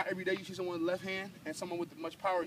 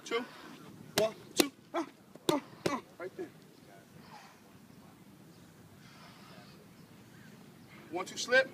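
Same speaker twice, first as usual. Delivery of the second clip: coach calling combos; 7 semitones higher than usual. Real, not acted. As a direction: bark the count at the fighter. Coach barks combos during drill.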